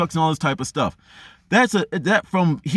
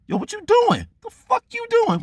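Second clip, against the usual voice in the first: much higher, mock-outraged inner voice; inner drill sergeant. Runner's inner voice yelling at him to keep going, high mock outrage. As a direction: snap at yourself for quitting. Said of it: "when I'm about to give up".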